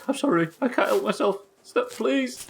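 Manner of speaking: high, strained voice of a zombie begging; pleading zombie. Retold or imitated. Zombie apologizing to its victim, high pleading voice. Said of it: "and then it'd stop and say".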